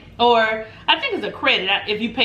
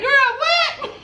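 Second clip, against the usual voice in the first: very high, laughing disbelief; can't contain the joy; shocked laughing what. Retold or imitated. Hearing about the suite upgrade, high laughing disbelief.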